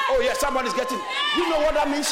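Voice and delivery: high shouted sermon, building with each repeat; full-force preaching. Real, not acted. Preacher daring the congregation to praise God, full-force preaching. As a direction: roar the refrains at the crowd.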